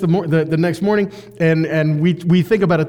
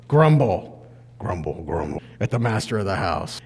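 grumbling; 10 semitones lower, 4 dB quieter than usual. Acted. Reading grumbling workers.

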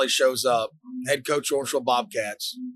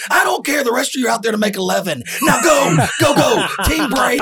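high, loud coach laughing through orders; mock coach; 10 semitones higher, 5 dB louder than usual. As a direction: yell it like a careless coach, half laughing.

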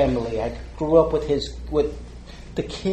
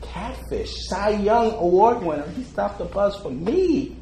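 stunned disbelief; 7 semitones higher, 3 dB louder than usual. Retold or imitated. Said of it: "it was like".

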